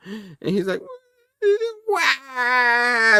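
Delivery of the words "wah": loud, high cartoon "wah"; long, exaggerated fake bawl; mock crying wail. Comic reader imitates Rick Rapp crying, mock wail. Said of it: "Rick Rapp is crying".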